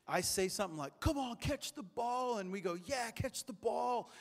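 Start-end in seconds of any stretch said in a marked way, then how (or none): 1.0-2.4 high, slow, drawn-out sports-fan shout; playful retold yell at the game on TV; mock yelling at the TV. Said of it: "screaming at the T V". 2.7-4.1 high, slow, stretched-out fan shout; retold shout egging on the fielder; mock yelling at the TV. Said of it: "screaming at the T V".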